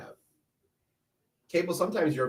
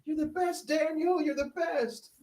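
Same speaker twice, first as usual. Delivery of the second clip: high, thin put-on voice; playful falsetto-like praise; mock cheerleading. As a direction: squeak out mock encouragement in a high voice. Mock supportive quote after dad remark.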